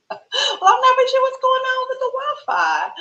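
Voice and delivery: high, laughing through the words. Real, not acted. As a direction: giggle while you talk about it. Laughing at the glitches.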